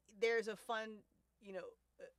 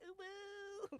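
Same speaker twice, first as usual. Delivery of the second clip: cute uwu; 13 semitones higher, 7 dB quieter than usual. Retold or imitated. Cute exclamation.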